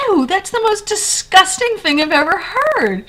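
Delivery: high, loud mock revulsion; imitated disgust. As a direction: act out a grossed-out friend exclaiming in horror. Acting out friend's disgust at fries.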